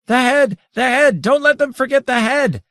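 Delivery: flaming cry. A narrator's reading. Daytorch urging the others about the head, flaming cry. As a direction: cry out as if aflame. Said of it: "cried in a flaming voice".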